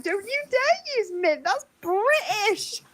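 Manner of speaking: high, slow put-on voice; mock outraged American. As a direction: stretch it out high like a scandalised Yank. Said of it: "I was like".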